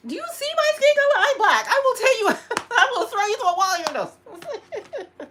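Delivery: loud, high mock threat with laughter; hammed-up threat. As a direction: yell it, half laughing at yourself.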